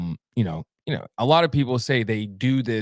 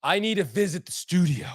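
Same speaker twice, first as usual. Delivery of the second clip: mock praying; 8 semitones higher, 4 dB louder than usual. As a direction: call out to heaven in a hammed-up prayer. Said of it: "He's praying to God right now".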